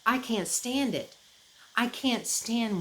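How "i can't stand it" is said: usual speaking voice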